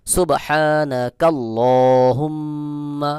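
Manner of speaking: very slow, linked সুবহানাকাল্লাহুম্মা with long vowels; ghunnah, joined reading. Shown as a demonstration. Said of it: "অবশ্যই গুন্নাহ হবে". (সুবহানাকাল্লাহুম্মা টেনে, গুন্নাহ করে)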